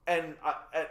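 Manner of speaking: ordinary delivery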